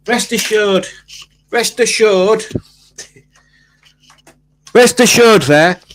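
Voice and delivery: high, loud booming announcement; loud proclamation. Acted. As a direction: boom it out like a town crier. Calling out over fog joke.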